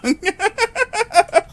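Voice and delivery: high, loud laughter. Real, not acted. Continued laughter.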